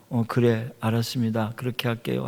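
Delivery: imitating a listless, fading phone answer; soulless reply. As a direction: agree with your mind somewhere else. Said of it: "나한테는 영혼이 없는 대답".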